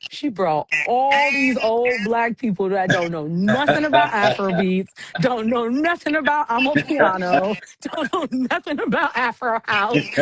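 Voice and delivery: tickled, laughing through the words; amused laughing. Real, not acted. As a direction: build the list until laughter breaks in. Comic list that sets off laughter.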